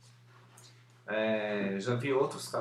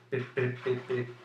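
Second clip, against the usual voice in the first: deliberate stutter. Shown as a demonstration. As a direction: repeat "pre-" in a stuttering loop. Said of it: "Eu gaguejei aqui de propósito".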